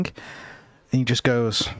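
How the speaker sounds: usual speaking voice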